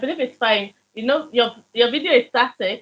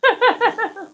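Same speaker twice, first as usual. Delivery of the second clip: high laugh; 11 semitones higher than usual. Real, not acted. Laughing at the broken connection.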